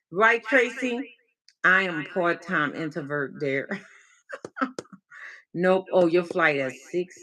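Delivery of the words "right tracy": normal talking voice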